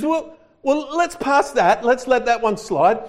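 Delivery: high, loud, grand angel voice; angel examiner. Retold or imitated. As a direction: boom out the questions like a pompous examiner. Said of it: "He says".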